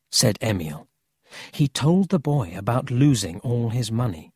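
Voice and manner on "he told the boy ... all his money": usual speaking voice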